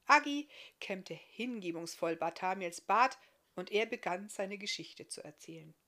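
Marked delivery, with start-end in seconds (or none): none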